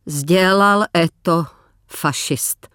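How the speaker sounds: slow, careful Russian, last word fading to a whisper. Acted. Katerina answers the officer in Russian, slow and fading. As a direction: answer in halting Russian, the end barely breathed. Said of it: "odpověděla Kateřina rusky".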